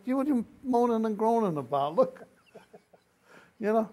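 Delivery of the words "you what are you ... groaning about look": gloating retort. Retold or imitated. Showing off cigars to Walt.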